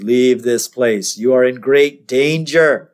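slow, portentous warning; measured mock-dramatic voice, drawn out; ominous hazmat man. Hazmat guy warning Bart away, slow and ominous. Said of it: "a guy in what looks like a spacesuit".

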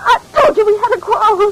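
shouting at her father, upset; distraught shout. Acted. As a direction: cry it out at her father in despair.

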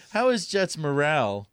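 normal talking voice

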